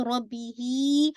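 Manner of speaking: रब्बी ही slow, ही stretched; recitation demo. Shown as a demonstration. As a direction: stretch ही gently. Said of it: "ही को आप stretch कर रहे हैं". (रब्बी ही, ही खींचकर)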